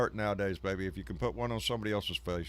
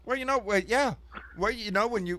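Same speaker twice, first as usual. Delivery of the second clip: excited rush; 11 semitones higher, 4 dB louder than usual. Real, not acted. Excited reply about Yang.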